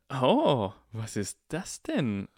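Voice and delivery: slow, sneering with spite; mock the owl cruelly; malicious drawl. A narrator's reading. Draco taunts Ron about his owl, sneering. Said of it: "schnarrte Draco mit einem boshaften Unterton".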